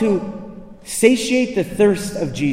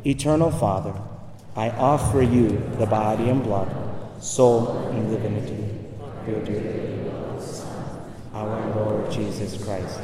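solemn prayer; 10 semitones lower, 7 dB quieter than usual. Acted. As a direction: pray it hushed and reverent. Leads the closing prayer.

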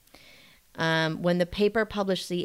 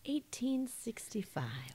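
dramatic storyteller whisper; 5 semitones higher, 13 dB quieter, slower than usual. Acted. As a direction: hush it like a ghost story.

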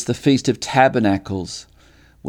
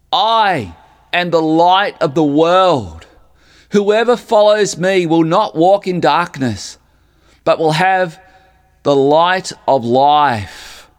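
proclaiming scripture; 6 semitones higher, 4 dB louder than usual. Acted. Jesus declaring to the crowds, grand and ringing. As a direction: ring it out from the temple steps.

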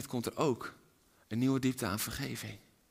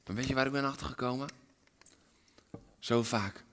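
ordinary talk

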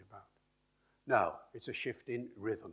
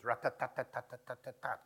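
even machine-gun rat-tat-tat; syllable-timed rhythm. Shown as a demonstration. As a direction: tap every tat out equally. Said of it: "That kind of rhythm".

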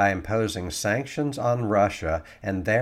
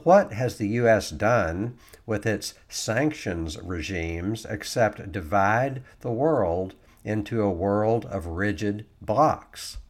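ordinary talk, nothing marked

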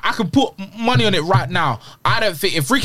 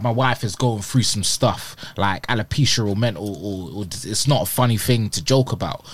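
lightly voiced statement; 11 semitones lower, 4 dB quieter than usual. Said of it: "would have captioned it".

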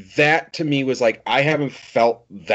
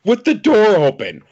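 incredulous outburst; 10 semitones higher, 6 dB louder than usual. Real, not acted. Outraged at character's dumb move.

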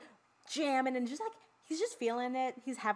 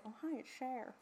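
quiet, throwaway mock [name] voice; a soft hint of [name]; [name] impression; 3 semitones higher, 10 dB quieter than usual. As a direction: toss off a [name] bit under the breath. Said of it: "I'm gonna say".

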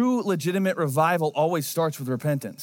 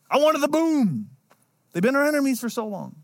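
whiny Jonah; 8 semitones higher than usual. Pretend. Jonah sulking to God for missing fireworks, whiny. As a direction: pout like a sulky prophet. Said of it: "he gets mopey and whiny".

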